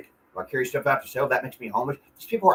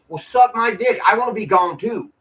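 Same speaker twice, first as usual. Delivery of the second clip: higher, louder angry outburst; real angry outburst; 8 semitones higher, 5 dB louder than usual. Real, not acted. Venting about the neighbors and cops, real anger, high and loud. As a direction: spit it out, fed up and furious.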